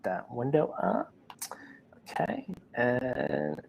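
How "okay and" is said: whispering. Real, not acted. Whispered aside.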